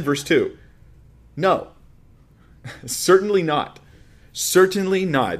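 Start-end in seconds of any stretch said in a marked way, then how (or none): 1.4-5.4 loud, higher, forceful refusal with NOT drawn out slowly; emphatic no. Acted. Preacher voices Paul's answer to continuing in sin, emphatic. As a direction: hit "No" and "Certainly not" hard. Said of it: "an emphatic no, or a very strong no".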